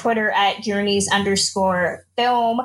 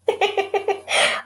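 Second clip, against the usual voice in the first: laughing; 10 semitones higher than usual. Real, not acted. Host laughs at guest's quip.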